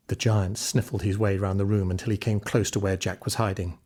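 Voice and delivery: ordinary delivery, nothing marked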